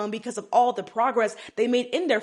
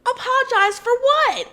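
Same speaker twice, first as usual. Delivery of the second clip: high, loud disbelieving question; indignant, high-pitched challenge; incredulous; 11 semitones higher, 5 dB louder than usual. Real, not acted. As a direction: throw it back in disbelief, pitch way up. Host reacts in disbelief to demand.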